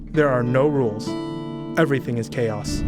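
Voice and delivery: ordinary delivery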